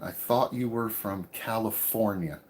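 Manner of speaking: usual speaking voice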